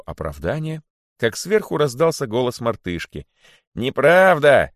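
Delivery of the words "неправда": loud, raised cry of protest; shouted denial. A narrator's reading. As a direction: shout it down from above. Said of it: "закричала она". (возмущённый крик)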